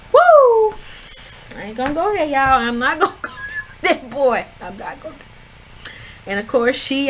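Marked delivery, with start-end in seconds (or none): 0.1-0.7 loud high whoop; gleeful loud woo; excited whoop. Real, not acted. Excited reaction to the show.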